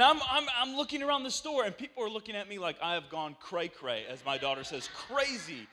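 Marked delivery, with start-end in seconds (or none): none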